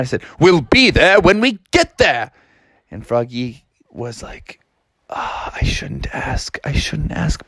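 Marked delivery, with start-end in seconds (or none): 0.4-2.3 higher-pitched irritated dad; getting mad. Acted. Dad answers Froggy's nagging, mounting irritation. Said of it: "getting a little bit more and more mad". 5.1-7.5 hushed, held-back little murmur; hesitant self-talk. Acted. Froggy resists asking his dad, hushed hesitation.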